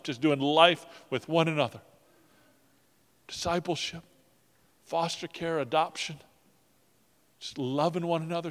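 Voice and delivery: fervent, rising prayer with swells of volume; heartfelt closing prayer; pastoral prayer. Acted. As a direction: pray over the congregation, building then settling.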